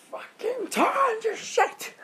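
shrill high yelled mock mum, slow and stretched; hammed-up frantic mum yelling at the kid; mum screaming. Mum screaming at her child on the quad bike, high frantic yell. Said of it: "she's going completely insane".

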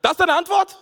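high incredulous squeal of a question; can't believe what he just heard; disbelief. Real, not acted. Narrator reacting to his uncle's absurd answer, high and incredulous.

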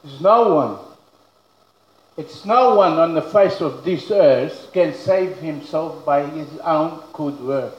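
usual speaking voice

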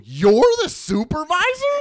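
high, loud, slow disbelief; goading, exaggerated amazement; mock incredulity. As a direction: stretch it out as an astonished question. Mock incredulous reply to worker.

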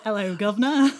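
hammed-up cheeky British hello; mock British accent. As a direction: tease with an exaggerated British accent. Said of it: "You were just like".

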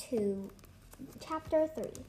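ordinary delivery, nothing marked

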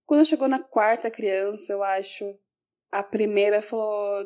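ordinary delivery

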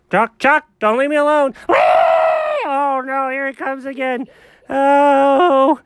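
high, loud terrified wailing; panicked. Acted. Left alone as the runner returns, panicked shrieking. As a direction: shriek for help as it comes back.

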